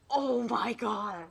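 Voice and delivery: slow, drawn-out loud gasp of amazement; amazed. Acted. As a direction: stretch it out in awe. Sees grown-up friends.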